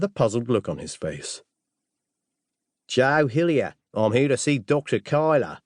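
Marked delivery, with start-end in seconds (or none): 2.9-5.6 broad Australian visitor, a little higher; strong Australian accent. Acted. Assassin to the butler at the door, strong Australian accent. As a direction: lay on a thick Aussie accent. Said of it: "in a strong Australian accent".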